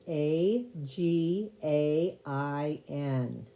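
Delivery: slow letter-by-letter spelling. Shown as a demonstration. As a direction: spell out each letter slowly and clearly. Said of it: "you spell again".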